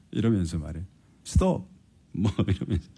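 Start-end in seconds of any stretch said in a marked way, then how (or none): 1.2-1.7 high mock cry of stop; imitated yelp. Preacher imitating a wife yelling at her driving husband, mock high yelp. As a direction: squeal it like a panicky passenger.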